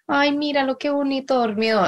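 a hint of a teasing Mexican parent in a skit; imitating the Viner. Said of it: "he's like".

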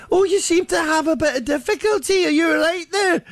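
high, jolly Scottish voice; chirpy Scots brogue, unbothered; Scottish accent. Retold or imitated. Scottish guy voiced in story.

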